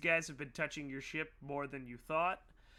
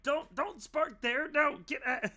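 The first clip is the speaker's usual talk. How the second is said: yelling; 11 semitones higher, 5 dB louder than usual. Acted. Mechanic yells at the crew tampering with his ship, high and loud. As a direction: shout at the crew to get away from the engine. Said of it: "you're yelling".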